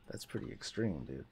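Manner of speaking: awed whisper; soft awed whisper. Real, not acted. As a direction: hush it under your breath. Awed at odd in-game sight.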